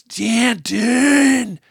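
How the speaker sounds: loud, high, heartbroken scream; anguished scream. Retold or imitated. Hogan screaming after the son who left him, anguished. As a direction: scream the name as if your heart breaks. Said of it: "this scream is heartbreak".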